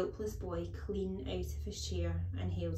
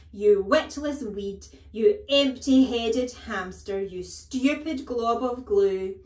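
slow, very loud, higher tirade; bellowing rage; 4 semitones higher, 14 dB louder, slower than usual. A narrator's reading. Headmistress abusing small boy, bellowing furiously. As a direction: relish each insult at a bellow. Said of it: "the Trunchbull bellowed".

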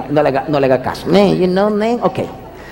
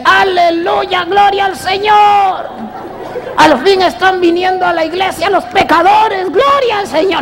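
mock shouting pastor; 9 semitones higher, slower than usual. [name] imitating the pastor shouting hallelujah in church. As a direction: belt it out like a joyful preacher. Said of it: "el pastor pega un grito y dice".

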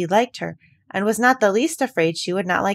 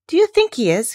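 higher, louder eager question; eager cry; 10 semitones higher, 7 dB louder than usual. A narrator's reading. Mary eagerly asking Dickon about the robin, higher and louder. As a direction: burst out with hope. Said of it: "cried Mary eagerly".

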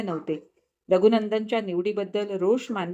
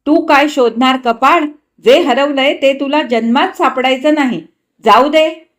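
much louder, higher curt retort; irritable snap; 5 semitones higher, 8 dB louder than usual. A narrator's reading. Husband snapping back at his wife, much louder. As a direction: bite back at her sharply. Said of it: "त्याने तिरसटपणे म्हटले".

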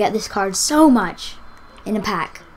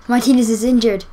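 ordinary talk

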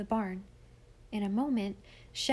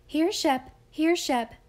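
calling a dog, high and loud; 6 semitones higher, 7 dB louder than usual. A narrator's reading. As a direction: call out sharply to the dog. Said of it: "she called loudly".

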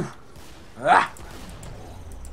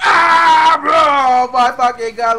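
excited shout; 6 semitones higher, 6 dB louder than usual. Real, not acted. Enemies rushing from above.

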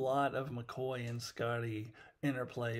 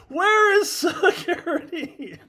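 incredulous loud cry; 13 dB louder, slower than usual. Real, not acted. Mock outrage at no security.

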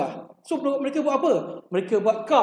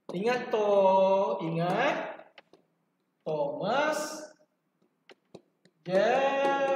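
slow and softer, drawing out Thomas Jefferson; slow hushed mnemonic; 4 dB quieter, much slower than usual. Shown as a demonstration. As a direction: let the name sink in gently. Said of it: "ajar mnemonik Thor". (perlahan dan lembut, memanjangkan Thomas Jefferson)

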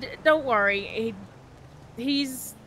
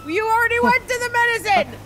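exasperated shout; 8 semitones higher, 5 dB louder than usual. Pretend. Scolds him while he drives off.

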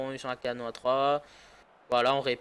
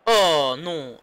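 ordinary delivery

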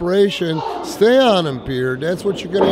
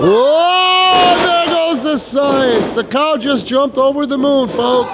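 excited; 6 semitones higher, slower than usual. Real, not acted. Wrestling commentator reacts to big move.

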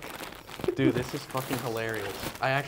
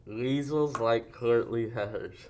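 slow, mushy words around a mouth guard; mouth guard speech; much slower than usual. Real, not acted. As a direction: talk through a mouth guard.

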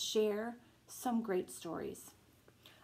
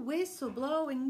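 gentle bedtime story reading; reading to children; slower than usual. Acted. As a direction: bedtime picture book read-aloud. Reading picture book aloud.

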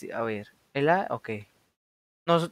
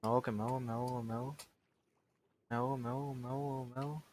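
panicked muttering; 4 semitones lower, 19 dB quieter, much faster than usual. Real, not acted. Character drowning underwater.

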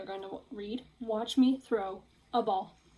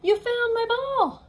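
high, louder excited pig voice; Piggy voice; 12 semitones higher, 8 dB louder, slower than usual. Acted. Piggy thrilled Gerald found her ball, excited. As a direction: bounce it up like an eager little pig.

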